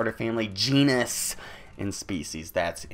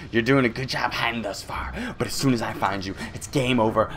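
ordinary delivery

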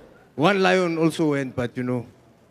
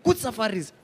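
lightly voiced eager tourists, softer; a soft, lightly mocking tourist voice; repeating good safaris like a chant; mimicking tourists; 6 dB quieter than usual.

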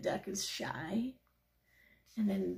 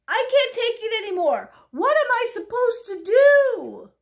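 high, very loud despairing outburst; pigeon freaking out; 14 semitones higher, 14 dB louder than usual. Acted. Pigeon at the end of its patience, freaking out. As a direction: wail it at full volume, at wits' end. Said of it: "the pigeon is freaking out".

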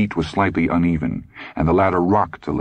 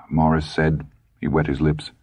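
ordinary delivery

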